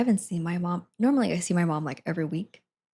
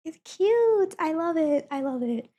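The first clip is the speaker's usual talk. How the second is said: high excited squeal; 9 semitones higher than usual. Real, not acted. Showing crop top.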